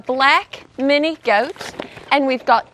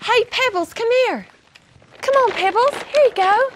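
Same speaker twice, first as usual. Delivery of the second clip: calling a pet; 10 semitones higher than usual. Real, not acted. Calling goat to her.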